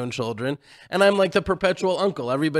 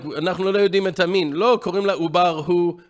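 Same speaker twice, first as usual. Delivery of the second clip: rapid Hebrew; 2 semitones higher, much faster than usual. Retold or imitated. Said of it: "And I'm like".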